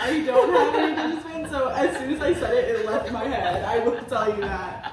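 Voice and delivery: loud confession through laughter; high, loud laughing babble settling down; still cracking up. Real, not acted. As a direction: admit it while laughing helplessly. Still laughing, explains losing her thread.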